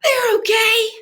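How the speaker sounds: put-on boy covering up tight shoes; boy pretending it's fine. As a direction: fake it, like the shoes fit fine. Boy character fakes shoes fit.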